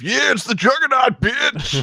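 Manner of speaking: high, shouted meme catchphrase; Juggernaut quote. Acted. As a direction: bellow it like a cartoon bruiser. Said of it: "rip the audio of".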